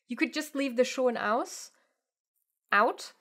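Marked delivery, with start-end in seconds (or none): none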